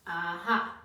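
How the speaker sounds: loud triumphant exclaim; triumphant aha. Acted. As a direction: crow it triumphantly. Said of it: "Dingo cried".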